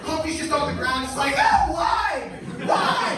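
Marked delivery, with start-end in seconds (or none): 1.4-3.2 high, loud comic wail; hammed-up anguished plea; mock despair. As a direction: cry out like a suffering egg. Humpty acted wailing at horses.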